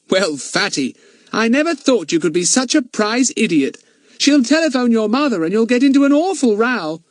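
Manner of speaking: put-on scolding boy voice. A narrator's reading. Friend scolds Fatty.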